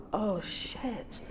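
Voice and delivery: whispered oath of dread; hushed alarm. Retold or imitated. Barmaid realising immigration has walked in, hushed alarm. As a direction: breathe it under your breath, almost voiceless.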